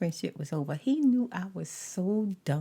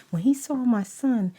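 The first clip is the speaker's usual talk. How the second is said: ordinary delivery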